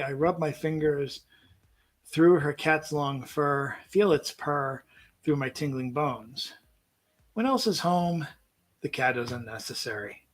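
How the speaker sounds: soft, unhurried poem reading. Acted. Host reads a cat poem on air, softly. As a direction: read the poem gently, letting lines land.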